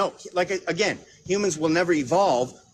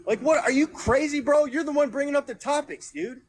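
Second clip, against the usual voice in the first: exasperated outburst; 7 semitones higher than usual. Real, not acted. Heated debate, exasperated outburst.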